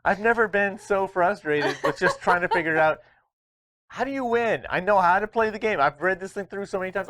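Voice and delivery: high laughing exasperation; exasperated. Real, not acted. Frustrated laughing complaint.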